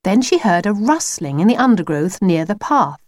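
usual speaking voice

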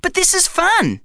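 put-on boy Zach. A narrator's reading. Zach answering his bum.

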